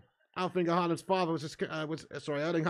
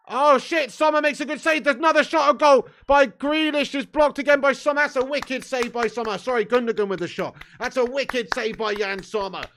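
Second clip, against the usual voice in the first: much higher, louder play-by-play; excited commentary; 9 semitones higher, 9 dB louder than usual. Real, not acted. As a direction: call the saves like a hyped commentator. Live reaction to match chances.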